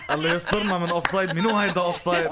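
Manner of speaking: laughing. Real, not acted. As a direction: push the words out through laughter. Host jokes, still laughing.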